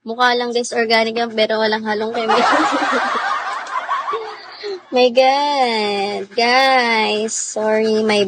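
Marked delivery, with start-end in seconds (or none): 2.5-4.3 a sudden high burst of laughter; cracking up at her own joke. Real, not acted.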